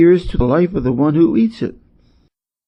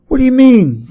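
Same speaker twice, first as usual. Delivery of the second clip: sudden high, louder alarmed question; jolted by bad news; 10 semitones higher, 5 dB louder than usual. A narrator's reading. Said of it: "said Monkey in alarm".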